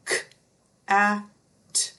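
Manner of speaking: "K, a, t" as separate isolated sounds; segmented sounds. Shown as a demonstration. Teacher showing the sounds in cat, one by one. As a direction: break "cat" into K, a, t with pauses. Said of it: "What are the sounds in cat?".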